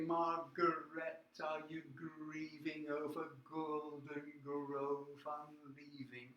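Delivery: slow, soft, tender recital; hushed, sentimental poetry reading; poem reading. Acted. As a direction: read it like a gentle autumn picture. Reads poem opening softly.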